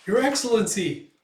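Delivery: alarmed cry. Acted. Guard shocked as the head hits the Baron, alarmed shout. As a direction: cry out as the head flies at your lord.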